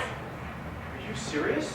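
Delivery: breathless whisper, very slow; stunned whisper. Acted. Husband whispering in shock at his wife's ultimatum. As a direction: barely get it out in shock.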